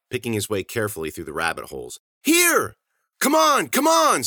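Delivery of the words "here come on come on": high, loud beckoning shouts; shrieked, pressing call; urgent cry. A narrator's reading. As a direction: yell them over to you. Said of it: "Susie cried as he approached".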